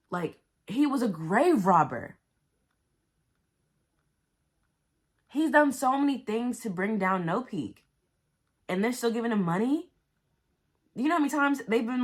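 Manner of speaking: real angry outburst; furious rant. Real, not acted. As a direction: vent hard at the villain. Furious rant about villain.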